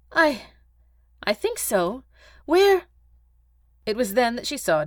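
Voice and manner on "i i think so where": high, halting, uncertain reply; dazed. Acted. Agatha shaken after the explosion, dazed. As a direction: answer haltingly, still shaken.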